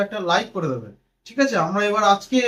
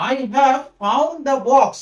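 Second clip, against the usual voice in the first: very slow, word by word, as if writing on the board; slow dictation; much slower than usual. Shown as a demonstration. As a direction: dictate it slowly for the class. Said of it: "আমি কি করে দিলাম". (বোর্ডে লেখার মতো খুব ধীরে বলা)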